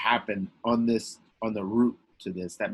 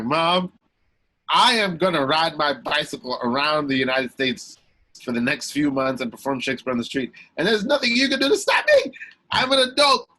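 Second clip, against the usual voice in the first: louder, rising higher to a defiant end; defiant declaration; 5 semitones higher, 7 dB louder than usual. Retold or imitated. Son defiantly announcing his bike trip to mom, loud and rising. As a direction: insist on it like a grown-up.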